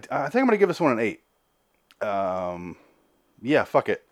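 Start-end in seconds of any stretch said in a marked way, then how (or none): none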